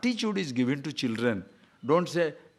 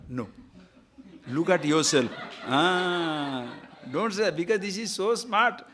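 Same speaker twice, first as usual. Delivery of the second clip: ordinary delivery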